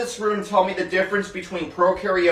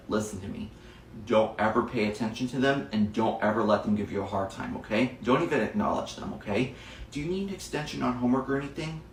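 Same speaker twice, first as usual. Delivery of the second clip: soft, low reassurance; calm a hurt kid quietly; comforting teacher; 6 semitones lower, 7 dB quieter than usual. Acted. Teacher quietly reassuring a bullied boy at her desk.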